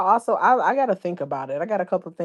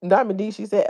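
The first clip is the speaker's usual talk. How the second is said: normal talking voice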